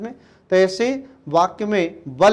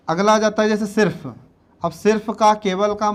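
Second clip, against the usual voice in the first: normal talking voice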